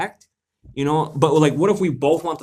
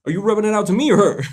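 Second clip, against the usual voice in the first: confronting call-out; 6 semitones higher, 5 dB louder than usual. Retold or imitated. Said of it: "I'd be like".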